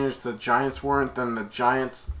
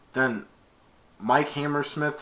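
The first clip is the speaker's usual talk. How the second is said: ordinary talk, nothing marked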